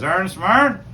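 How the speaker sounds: Newfoundland captain's high, loud question; Newfoundland dialect. Acted. Fishing captain hailing another captain, in Newfoundland dialect. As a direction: call it out in run-together Newfoundland dialect. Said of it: "looks over at the other one and he says".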